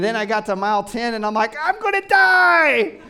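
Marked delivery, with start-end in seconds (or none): 1.6-3.0 very high, loud, long-drawn-out cry; hammed-up scream of a runner at the limit; mock agonized wail. Runner despairing at mile ten, mock agonized high wail.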